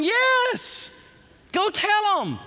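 high, loud, urgent; panicked clerk. Retold or imitated. Desk clerk urging the preacher on, high panicked cry. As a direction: shout the order in a panic. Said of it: "Do I need to go tell them".

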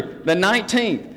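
normal talking voice

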